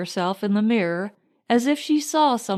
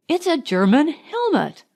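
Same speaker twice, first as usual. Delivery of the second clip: mild excited cry; 6 semitones higher, 3 dB louder than usual. Acted. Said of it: "cried Marjorie".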